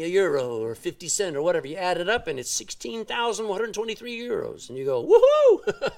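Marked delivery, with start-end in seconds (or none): 5.0-5.9 high, loud laughing whoop of delight; delighted laughing woohoo; joyful whoop. Pretend. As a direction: cheer like you just saw a fat bank balance. Cheering at bank balance.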